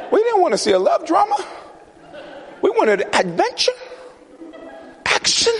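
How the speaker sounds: very high, fast complaining voice; disappointed gang. Acted. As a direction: whine that you wanted action. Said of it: "These guys will be like".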